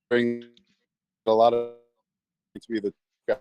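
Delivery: choppy glitching connection audio; robotic breakup. Real, not acted. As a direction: voice cutting in and out like a robot. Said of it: "You sound like a robot".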